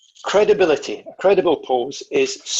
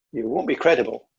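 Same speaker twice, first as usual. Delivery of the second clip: ordinary talk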